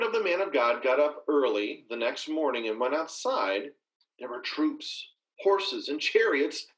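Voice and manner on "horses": ordinary talk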